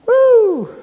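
a sudden high, loud whoop. Acted. Preacher whoops about the sons of thunder, excited whoop. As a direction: let out a big excited whoop.

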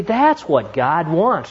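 ordinary delivery

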